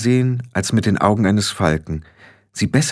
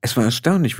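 ordinary delivery, nothing marked

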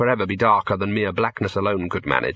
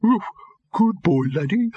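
high, feeble talking-dog bark; barely managed doggy yap in words; faint dog bark; 9 semitones higher than usual. A narrator's reading. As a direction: bark the words weakly, pitched well up. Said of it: "managed the faintest of barks".

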